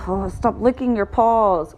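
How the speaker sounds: normal talking voice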